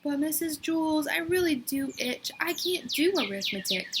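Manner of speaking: Dana begging to skip arithmetic; whining. A narrator's reading. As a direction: beg the teacher, sounding sorry for yourself. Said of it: "Dana whined".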